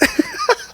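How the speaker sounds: very high, soft giggle; squeaky amused laughter; real laugh. Real, not acted. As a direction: laugh at your own absurd story. Laughs at his own story.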